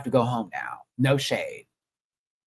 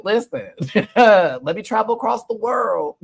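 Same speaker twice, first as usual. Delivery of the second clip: laughing; 10 semitones higher, 7 dB louder than usual. Real, not acted. Laughing about being single.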